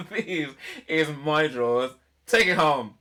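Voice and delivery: loud, emphatic mock showmanship; hammed-up big finish. Finishing the ridiculous song's chorus, hammed-up and loud. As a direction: belt out the closing line.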